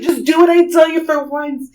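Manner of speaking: high, loud acted exasperation; exasperated order. Aunt to her doubting husband, exasperated and urgent. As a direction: push it out, fed up and frantic.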